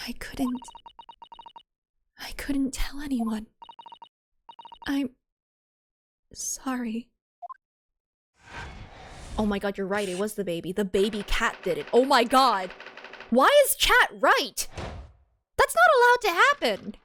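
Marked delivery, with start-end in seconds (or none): 0.1-7.1 breathy guilty whisper. Acted. Witness confesses in whisper. 11.9-16.9 loud, high disbelieving outburst; outraged shout. Real, not acted. Outraged that chat was right.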